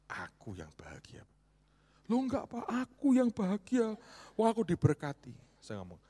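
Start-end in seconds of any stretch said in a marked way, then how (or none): 0.1-1.2 whispered reply. Retold or imitated. Pastor's humble reply. 2.1-5.3 much higher and louder, slowed, delighted; excited exclamation. Retold or imitated. Friend exclaims joyfully, retold.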